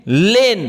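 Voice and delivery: लेन stretched out, with a long ए in the middle; teacher's drawn-out model of लेन, rising then falling; blended phonics word. Shown as a demonstration. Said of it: "एआई का उच्चारण ए".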